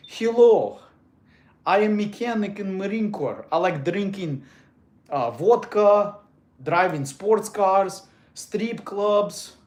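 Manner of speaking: slow, heavy Russian accent; thick Russian accent. Shown as a demonstration. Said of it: "really thick Russian accent".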